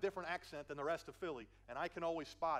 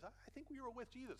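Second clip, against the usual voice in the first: put-on higher, softer voice of a young girl; lightly pitched-up, quieter servant girl; 7 semitones higher, 6 dB quieter than usual. Servant girl speaking to Peter by the fire, high and soft. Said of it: "but it's a girl, she says".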